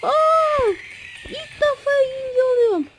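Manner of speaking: real high-pitched joy; excited squeal. Real, not acted. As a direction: squeal with delight at a surprise result. Surprised to finish second.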